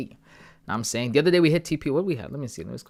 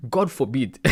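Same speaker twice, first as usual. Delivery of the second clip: laughing; 6 semitones higher, 5 dB louder, slower than usual. Real, not acted. Laughing at chat.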